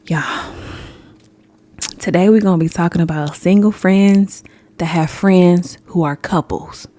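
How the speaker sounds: usual speaking voice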